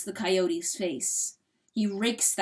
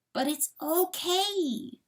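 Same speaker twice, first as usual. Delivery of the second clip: slow, higher soothing coyote voice; gentle reassurance; 9 semitones higher, slower than usual. Acted. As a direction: reassure him tenderly, drawing it out. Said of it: "the coyote repeats".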